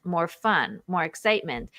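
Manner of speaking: normal talking voice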